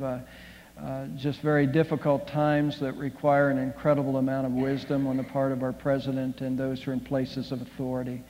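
soft, earnest prayer voice; pastoral prayer. Acted. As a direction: pray over the congregation, low and gentle. Leads congregation in prayer.

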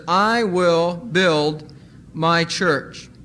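slow, weighty quote of the verse; slow scripture reading; slow, deliberate reading. Shown as a demonstration. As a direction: read it slowly and solemnly. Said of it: "If we put the emphasis upon the "I".

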